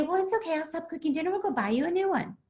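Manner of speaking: high-pitched, placating reassurance; fix-it parent. Acted. Triggered parent tries to fix child's loss, placating. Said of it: "so I try to fix it".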